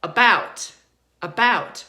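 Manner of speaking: "about" starting on a reduced uh, stressed on BOUT; light uh, then a strong "bout" in "about"; schwa in about. Shown as a demonstration. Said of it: "So it sounds like".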